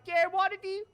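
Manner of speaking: squealed very high and very loud; over-the-top catchphrase. Retold or imitated. Man outdoing the officers' greeting, very high loud squeal.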